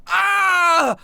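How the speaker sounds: high, loud dying goon wail; pained cry. Acted. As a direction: cry out as if shot in the foot. Goon shoots his foot, cries out.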